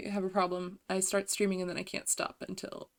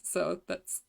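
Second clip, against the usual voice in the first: ordinary talk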